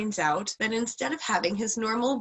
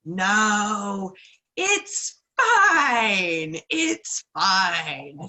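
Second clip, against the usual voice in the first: insistent spider; 3 semitones higher, 7 dB louder, much slower than usual. Retold or imitated. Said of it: "And he's like".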